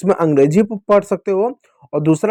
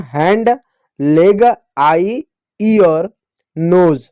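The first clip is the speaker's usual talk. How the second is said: slow drawn-out recap of the words; much slower than usual. Shown as a demonstration. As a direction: stretch each word slowly for the class. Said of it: "तो पूरा शब्द क्या बना?". (धीमे, खिंचे हुए शब्द)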